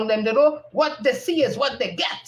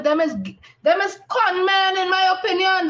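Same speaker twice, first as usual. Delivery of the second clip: emphatic rant; 7 semitones higher than usual. Real, not acted. Ranting about con men.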